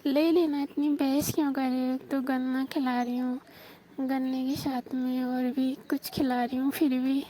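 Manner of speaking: ordinary delivery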